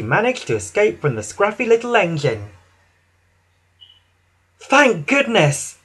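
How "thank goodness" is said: high, slow sigh of relief; Emily. A narrator's reading. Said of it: "Emily wheezed".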